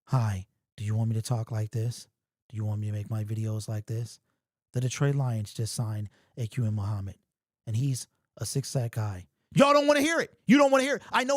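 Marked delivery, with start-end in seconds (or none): 0.1-9.3 much lower and softer, smooth and even, no animation; mock calm broadcaster voice. Shown as a demonstration. Mocking himself for viewers who want a calmer host. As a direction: parody a calm, polished sports host. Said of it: "I post a video and I do this".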